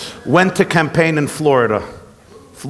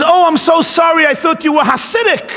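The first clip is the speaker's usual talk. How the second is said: gushing relieved woman; 11 semitones higher, faster than usual. Retold or imitated. Rabbi telling a joke, woman on plane apologising, high gushing voice. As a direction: sound falsely relieved and gushing.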